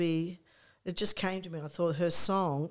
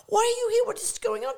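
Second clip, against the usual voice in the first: high, loud squeal; high-pitched gushing curiosity; excited questioning; 6 dB louder, faster than usual. Retold or imitated. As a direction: imitate a thrilled friend firing questions. Acting out an excited reunion.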